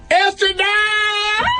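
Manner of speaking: very high, slow yell of the name; mother's yell. Acted. Mother calling runaway child.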